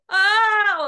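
a high, loud sung "Ah" held out; loud vocal siren on ah; vocal warm-up ah. Shown as a demonstration. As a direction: belt a bright "Ah" to warm up the voice. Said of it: "I go like".

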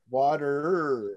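"Water" with a long drawn-out R; stretched American "Water"; exaggerated R. Shown as a demonstration. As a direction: hang on the final R of "Water". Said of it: "If you said it like".

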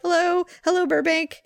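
high, lilting hello to adoring fans; mock-presidential greeting; greeting the crowd. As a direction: play a visiting dignitary greeting a crowd. Waving to imagined cheering crowd.

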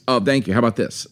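ordinary delivery

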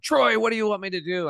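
cri aigu vers le frère; high desperate cry to his dead brother; screamed out. Retold or imitated. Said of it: "I screamed out".

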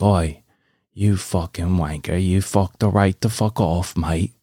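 gruffly insulting mock British; British accent. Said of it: "talking like this".

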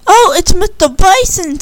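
put-on a young girl spotting a friendly visitor, excited. A narrator's reading. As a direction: spot the visitor with delight. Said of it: "said Candy".

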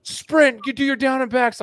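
high, loud barking coach; yelling coach. Retold or imitated. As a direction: bark orders like a gym coach. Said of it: "they'll be yelling at you".

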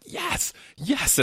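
high-pitched excited cheer; high squeal of enthusiasm; fired up. Retold or imitated. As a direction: re-enact an eager, elated yes. Acting out excitement at events.

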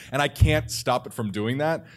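ordinary delivery

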